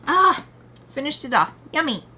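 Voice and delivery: loud happy exclaim; excited. Real, not acted. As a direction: exclaim with delight. Finishes the last of the tea.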